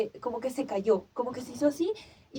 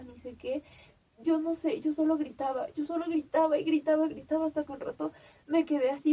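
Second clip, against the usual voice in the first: ordinary delivery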